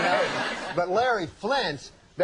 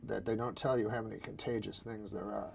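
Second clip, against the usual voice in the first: hushed confession; 7 semitones lower, 7 dB quieter, faster than usual. Real, not acted. Drops voice, confessional.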